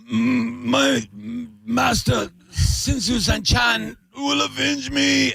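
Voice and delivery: high, slow dramatic dying shout; overacted death scene. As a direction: ham up a kung fu death line. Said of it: "and they go".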